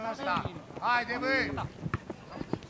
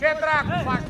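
loud, higher scolding; angry shout; 4 semitones higher, 8 dB louder than usual. Real, not acted. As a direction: berate the player from the sideline. Coach berates a player. (ceartă strigată, tare)

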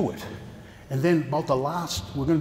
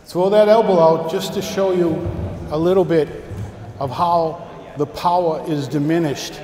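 raised voice over the room; loud coaching; 3 semitones higher, 6 dB louder than usual. Real, not acted. Sensei calls instructions to partners drilling, loud. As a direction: project the instructions across the dojo.

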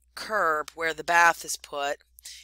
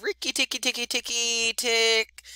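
higher, chattering mongoose battle cry; mongoose war cry; 6 semitones higher than usual. A narrator's reading. Rikki Tikki sounds his war cry at night, a higher chattering call. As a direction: chatter it out like a fierce little mongoose. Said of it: "his long war cry of".

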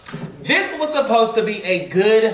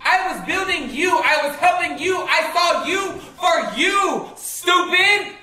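high-pitched, indignant reproach; exasperated, rising outburst; hurt, angry berating; 9 semitones higher than usual. Real, not acted. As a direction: hammer each thing you did for him. Berating the man she helped.

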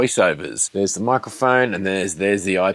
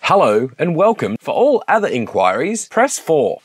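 higher, polished automated menu read; phone menu voice; 5 semitones higher than usual. Shown as a demonstration. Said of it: "that was a take".

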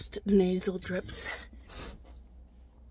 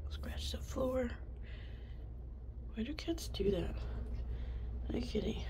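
whispering; 4 semitones higher, 13 dB quieter than usual. Real, not acted. Talking softly to the cat.

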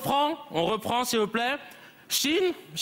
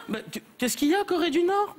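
ordinary talk, nothing marked

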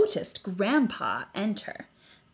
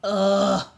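disgusted ugh; 3 semitones lower, 8 dB louder than usual. A narrator's reading. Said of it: "said the other naked mole rats".

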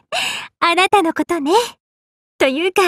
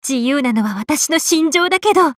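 ordinary talk, nothing marked

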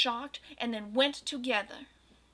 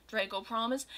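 usual speaking voice

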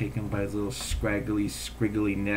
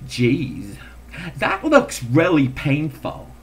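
New York customer; 2 semitones higher, 4 dB louder, slower than usual. Retold or imitated.

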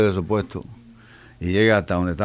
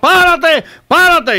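high, loud shout to halt; acted shouting; 6 dB louder, much slower than usual. Knifeman chasing a man who runs ahead, shouting. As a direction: yell it like a man giving chase. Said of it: "le está gritando".

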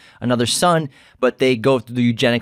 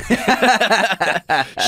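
high burst of laughter; 10 semitones higher than usual. Real, not acted. Laughing at dinosaur joke.

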